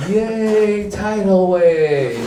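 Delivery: put-on high, loud, drawn-out child's cheer; kids cheering. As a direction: cheer like a kid in a car wash. Kids in the car wash.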